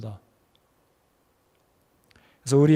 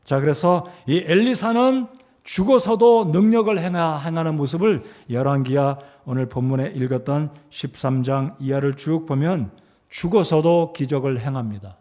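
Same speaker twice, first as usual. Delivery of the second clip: normal talking voice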